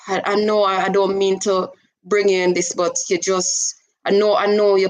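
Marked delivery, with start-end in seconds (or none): none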